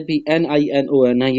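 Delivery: normal talking voice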